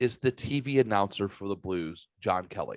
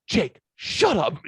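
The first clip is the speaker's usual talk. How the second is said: high, slow, drawn-out mock reprimand; retold scolding; 8 semitones higher, 3 dB louder, slower than usual. Relative shushing Jake at the wake, mock scolding. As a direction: imitate her telling him off, pitched up.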